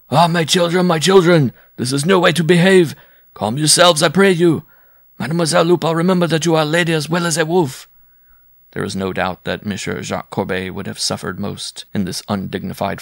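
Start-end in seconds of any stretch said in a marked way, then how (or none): 0.1-7.9 loud, high, pleading bellow; bellowing for peace. A narrator's reading. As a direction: shout over the brawl, begging them to calm down. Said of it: "bellowing for peace".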